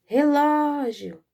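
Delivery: high, loud call with a long LÓ in Relógio; slow, drawn-out loud call. Shown as a demonstration. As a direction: call Relógio from afar, holding the middle syllable. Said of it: "se nós chamarmos como nós chamamos".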